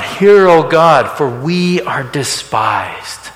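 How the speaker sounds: slow, with a loud cry on the first words; crying out to God. Retold or imitated. Nehemiah calls out to God in prayer, with a slow pleading cry. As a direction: plead upward, drawn out. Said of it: "he calls out to God".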